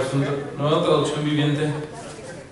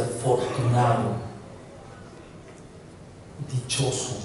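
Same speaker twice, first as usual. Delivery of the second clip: soft, lowered voice with long pause; tender consolation; 4 semitones lower, 8 dB quieter than usual. Acted. Jesus reaching out to someone crying, tender. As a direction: console gently, word by word.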